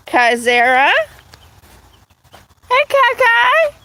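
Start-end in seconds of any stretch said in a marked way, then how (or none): 2.7-3.7 cat-talk voice. Real, not acted. Owner greeting her cat in a high coo.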